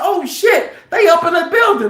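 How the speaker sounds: high-pitched astonished exclamation; surprised. Retold or imitated. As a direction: blurt it out, amazed and high.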